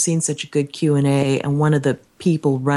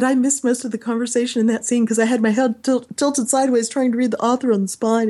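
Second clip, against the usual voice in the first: excited confession; 7 semitones higher than usual. Retold or imitated. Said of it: "And I said".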